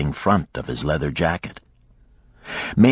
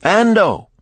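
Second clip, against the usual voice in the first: higher-pitched summons to a servant; commanding; 5 dB louder than usual. A narrator's reading. Lender summons his slave, commanding. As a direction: call out the order.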